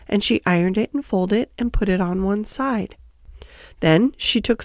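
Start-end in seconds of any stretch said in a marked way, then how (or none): none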